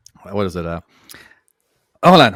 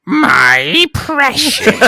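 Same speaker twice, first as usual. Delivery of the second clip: high, slow, drawn-out my precious; Gollum voice; 10 semitones higher, much slower than usual. Pretend. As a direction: croak it out like Gollum. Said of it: "Say my precious in that voice".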